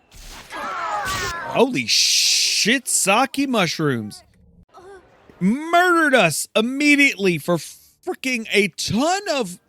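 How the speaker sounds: real disbelief at the sudden wipe; shocked outburst. Real, not acted. Player seeing the party killed at once, shocked shout.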